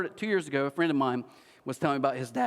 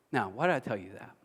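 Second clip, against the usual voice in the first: ordinary talk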